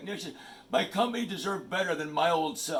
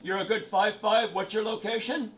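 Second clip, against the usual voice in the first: radio operator; 4 semitones higher, 3 dB louder than usual. Real, not acted. Ham radio reply on air.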